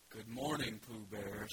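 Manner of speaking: low, flat, mopey donkey; gloomy Eeyore. Acted. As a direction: answer like nothing could be good. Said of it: "said Eeyore gloomily".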